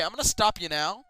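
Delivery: usual speaking voice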